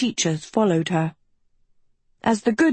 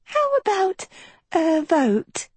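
very high, bright chirp; chirpy; 11 semitones higher, slower than usual. A narrator's reading. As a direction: pipe it up sweetly. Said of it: "chirped Uma".

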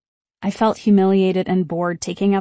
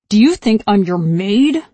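loud snarl through gritted teeth; 2 semitones higher, 7 dB louder than usual. Acted. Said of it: "She said it through her teeth, snarling".

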